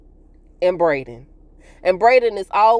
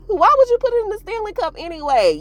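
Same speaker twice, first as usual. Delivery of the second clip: laughing; 14 semitones higher, faster than usual. Real, not acted. Laughing at the show's choice.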